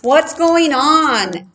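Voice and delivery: high, loud, slow cowboy shout; hearty cowboy asking loudly; cowboy calling out. A narrator's reading. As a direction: call out like a rancher arriving. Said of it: "asked Stan".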